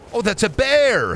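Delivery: high, swooping cry of surprise; playful startled exclamation; mock alarm. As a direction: stretch it out and leap up in pitch. Spots a bear in game.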